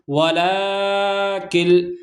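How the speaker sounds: ولا کل chanted slowly, with the la drawn out; slow recitation. Shown as a demonstration. Teacher reciting ولا کل slowly for students. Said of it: "کیونکہ لام پر تشدید ہے".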